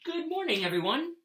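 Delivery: high on "morning", cheerful; Billy's greeting. Acted. Teacher voicing Billy greeting the class. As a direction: call out a friendly hello. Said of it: "said Billy".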